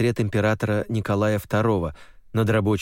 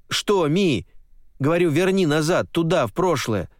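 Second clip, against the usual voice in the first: insistent plea; 8 semitones higher than usual. Acted. Hero insists to shaman.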